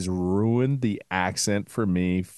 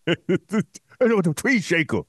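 Troy from Swamp People; 6 semitones higher than usual. Retold or imitated. Impersonating Troy in Cajun accent. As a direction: call out like the Cajun gator hunter. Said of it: "Troy from the Swamp People".

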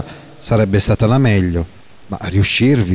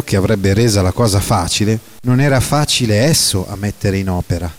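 ordinary delivery, nothing marked

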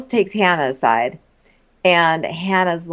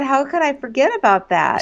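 normal talking voice

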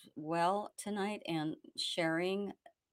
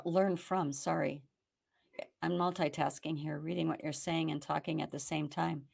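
ordinary delivery

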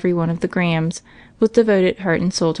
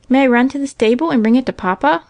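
put-on higher-pitched little boy asking; lightly voiced child's eager request; Johnny's eager cry; 6 semitones higher, 3 dB louder than usual. A narrator's reading. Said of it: "cries Johnny".

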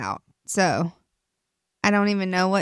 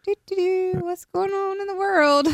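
sing-song musing; 10 semitones higher than usual. Retold or imitated. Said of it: "It's just like".

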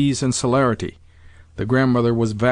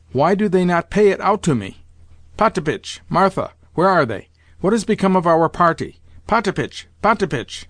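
higher-pitched, impatient questions; fussy, raised-pitch calling; agitated calling out; 5 semitones higher than usual. A narrator's reading. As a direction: summon the servants impatiently. Excited grandmother calls for her servants.

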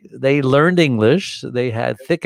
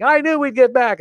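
higher, cocky relieved claim; boastful crew; 3 dB louder than usual. Retold or imitated. Crewman boasting after the storm, higher relieved voice. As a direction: brag now that it's over. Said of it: "everyone starts celebrating".

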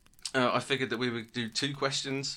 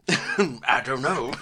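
laughing mimicry; 7 dB louder, much slower than usual. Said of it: "co-host mimics Jake laughing".

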